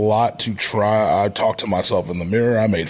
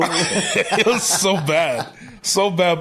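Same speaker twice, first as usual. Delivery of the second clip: slow, laughing through the words; 8 semitones higher, much slower than usual. Real, not acted. Wrestler laughing at his old pretty-boy gimmick, laughing. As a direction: laugh at your own embarrassing past.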